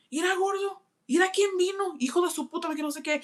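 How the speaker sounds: high, shrill-free mom calling out to dad; high-pitched mother voice in Spanish. Retold or imitated. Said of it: "And she was like".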